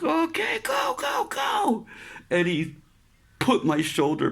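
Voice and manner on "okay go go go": much higher, rising urgent yell; bracing shout. Retold or imitated. Sailor gripping a metal bar, yelling go. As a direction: brace and yell go before the pain. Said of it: "I'm like".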